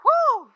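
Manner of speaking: a loud, high exhale of being moved; awed whew. Real, not acted. Host to guest after her story, a loud high whew. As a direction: let the breath out, overwhelmed.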